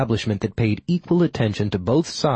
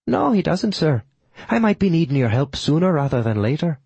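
higher-pitched Irish lilt; reply in an Irish brogue; respectful Irish accent, pitched up; 5 semitones higher than usual. A narrator's reading. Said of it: "I replied in kind".

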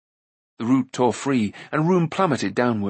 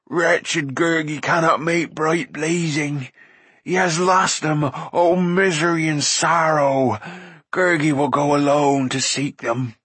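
slow, higher creature wail; moaning; 4 semitones higher, 3 dB louder than usual. A narrator's reading. Creature confessing lost flints, miserable moaning. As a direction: lament wretchedly in an odd creature voice. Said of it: "Gurgi moaned".

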